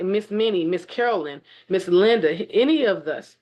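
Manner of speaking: ordinary delivery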